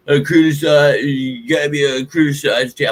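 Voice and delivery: garbled mock-critic voice; mocking imitation. Host mimicking people who say they criticize the team, mocking them. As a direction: parody complainers in a put-on voice.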